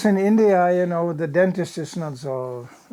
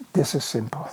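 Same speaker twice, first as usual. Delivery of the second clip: lower, softer musing; inner thought; 5 semitones lower, 6 dB quieter than usual. Retold or imitated. Teller marvelling at the old man, low quiet thought. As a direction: think it quietly to yourself.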